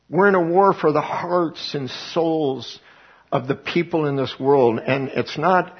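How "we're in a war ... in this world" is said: we're in a war said slowly, each word weighed; slow down and let it land; repeating the battle line slowly for weight; slow, weighty repeat. Real, not acted. Preacher repeating declaration.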